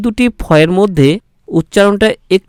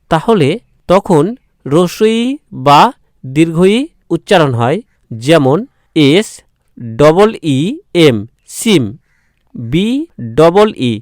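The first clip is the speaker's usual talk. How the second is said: ordinary talk, nothing marked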